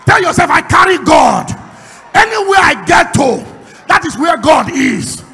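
shouted, rousing sermon climbing to a roar; full-force preaching. Real, not acted. Preacher telling the congregation they carry God, roaring declaration. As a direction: preach it at the top of your voice.

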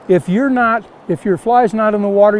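ordinary talk

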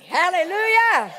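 loud, high, drawn-out hallelujah; exultant shout. Real, not acted. Preacher rejoicing before the congregation, exultant shout. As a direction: belt it out in praise.

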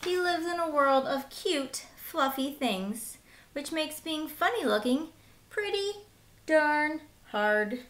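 put-on bright, lively read-aloud for kids; lively children's storyteller; storybook narration. Reading picture book to children.

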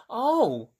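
plain reading voice

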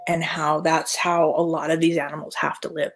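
ordinary delivery

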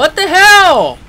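loud, slow startled what the hell; jolted by a sudden ghost. Real, not acted. Gamer spooked by ghost, loud startled cry.

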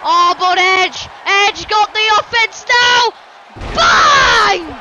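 loud play-by-play building to a high yell; excited shouting. Real, not acted. Commentator calls Edge getting the offense, excited shouting. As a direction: shout the comeback, biggest on the last word.

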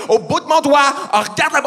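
high, loud insistence; hammed-up frustrated command; exasperated dog owner. Owner losing patience with the dog, exasperated insistence.

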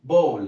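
ball repeated loud as bol, falling; loud teacher model of ball; model pronunciation. Shown as a demonstration. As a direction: say ball for students to copy. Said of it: "كلمة إيه؟".

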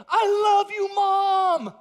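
very high, very loud joyful yell; ecstatic shout. Retold or imitated. Golfer filming a video for his mom, ecstatic shout. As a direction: yell it into the camera.

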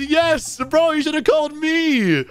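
high-pitched, emphatic complaint; acted indignant outburst; worked-up customer. Upset customer's reply read out.

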